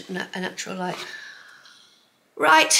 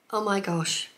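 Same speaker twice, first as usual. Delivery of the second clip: ordinary talk, nothing marked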